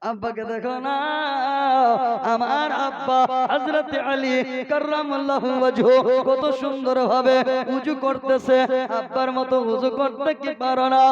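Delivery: loud, chanting sermon delivery; preaching at full force. Acted. Preacher telling the crowd about Hasan and Husain, full-force preaching. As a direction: preach it to the whole gathering.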